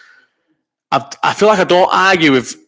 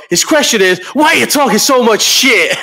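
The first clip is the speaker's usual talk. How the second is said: imitating McGregor; 10 semitones higher, 3 dB louder than usual. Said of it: "And then McGregor goes".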